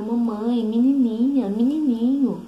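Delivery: put-on high, loud child's call across the playground; little girl calling out. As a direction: call like a toddler looking for playmates. Daughter calling for other kids.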